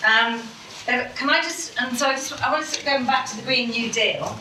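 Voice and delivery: normal talking voice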